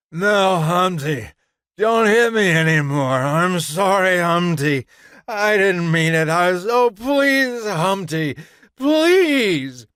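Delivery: high, loud frightened pleading; desperate begging. A narrator's reading. Woman begs while being beaten.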